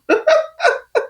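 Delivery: squealing laugh, high; high burst of laughter. Real, not acted. Vlogger laughing at her own confession to viewers, squealing.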